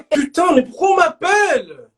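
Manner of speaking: loud, high, laughing yell; laughing shout at the chat; laughing outburst. Real, not acted. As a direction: burst out laughing and yelling. Laughing outburst mid-stream.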